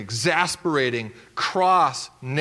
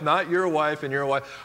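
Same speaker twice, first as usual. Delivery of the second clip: ordinary talk, nothing marked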